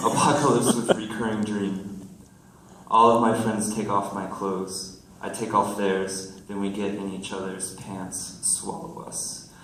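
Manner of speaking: loud, projected verse delivery; poem reading. Acted. Poet reading a friends poem to the room, projected recital. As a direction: announce the title, then declaim the lines.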